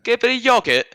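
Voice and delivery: high, put-on British "get a bit of yogurt"; mock British accent. Australian imitating British coworkers, mock British accent. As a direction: mimic a Brit saying "yogurt". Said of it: "how they say yogurt".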